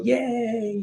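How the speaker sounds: high, soft playful "yay"; mock cheer. Parent cheering a child's discovery, high playful cheer. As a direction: cheer like praising a toddler. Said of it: "we clap and go".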